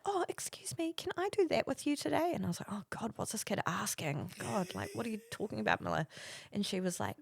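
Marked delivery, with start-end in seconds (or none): 0.1-2.3 put-on high, soft polite child; little kid asking permission, high and soft; imitated young son. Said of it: "he walks in, and he's like". 2.7-6.1 hushed mock dismay; mum's exasperated aside under her breath; muttered inner thought. Mum's worried thought at child's question.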